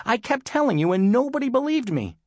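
put-on high, upset boy's complaint; close to sobbing. A narrator's reading. Tom telling mom nobody believed him, close to sobbing. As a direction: plead it high-pitched, nearly in tears. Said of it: "could only just suppress a sob".

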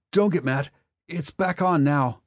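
put-on high, loud worried boy voice; plead, voice trembling. A narrator's reading. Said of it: "Wesley's voice trembled".

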